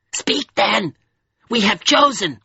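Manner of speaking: self-abandoned cry. A narrator's reading. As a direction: give in with a high, despairing cry. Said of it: "in a tone of weary self-abandonment".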